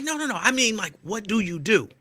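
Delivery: high pressing clerk; mock clerk voice. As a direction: push the question like a nosy clerk.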